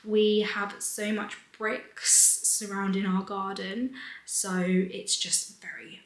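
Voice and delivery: ordinary delivery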